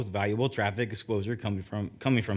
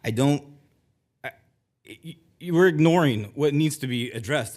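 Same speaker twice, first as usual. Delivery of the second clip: real upset, stammering, then louder; emotional; 2 semitones higher, 6 dB louder than usual. Real, not acted. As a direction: let the emotion break through the words. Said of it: "I'm getting a little bit emotional".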